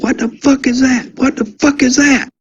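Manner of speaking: high, startled shout, retold; panicked yell. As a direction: imitate a spooked new guard yelling at something in the sky. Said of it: "started yelling and said".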